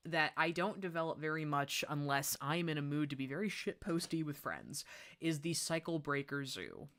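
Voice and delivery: usual speaking voice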